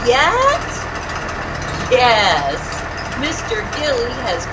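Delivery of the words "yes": usual speaking voice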